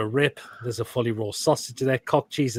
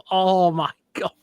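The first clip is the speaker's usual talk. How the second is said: disgusted exclaim; 7 semitones higher, 6 dB louder than usual. Real, not acted. Disgusted by a chicken burger.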